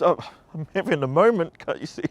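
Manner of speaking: mock-offended retort. Answering her friend mid-tears, mock-indignant and high.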